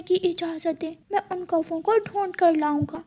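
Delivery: put-on high, soft child bird; a small son warning his mother gently; little chick voice. Chick warns mother not to trust crow, high and soft.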